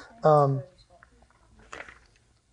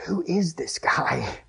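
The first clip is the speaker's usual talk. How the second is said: slow, softer, awestruck question; awed fear; 5 dB quieter, slower than usual. Acted. Disciples to one another after the storm calms, awed murmur. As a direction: draw it out in hushed wonder. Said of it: "they were filled with great fear".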